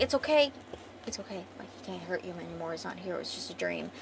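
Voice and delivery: very soft, hushed comforting; gentle reassurance. Retold or imitated. Elf calming her after the nightmare, soft reassurance. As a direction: soothe her quietly as you retell it. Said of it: "he's comforting her, reassuring her".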